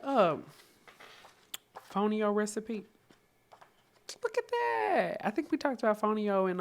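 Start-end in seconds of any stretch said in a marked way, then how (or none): 4.2-6.6 up high, pleased; high delighted voice. Real, not acted. Spotting a familiar grain, delighted and high.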